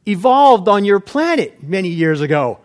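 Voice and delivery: a hint of a smug creature; lightly voiced fish-man; mock alien. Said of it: "he says".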